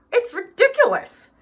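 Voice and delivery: high indignant outburst. Acted. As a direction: scoff at the very idea. Dog refuses to roll over.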